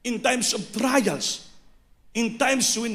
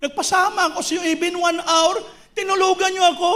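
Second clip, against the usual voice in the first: rebuking Jesus; 8 semitones higher, 4 dB louder, faster than usual. Retold or imitated. Jesus rebuking sleeping apostles.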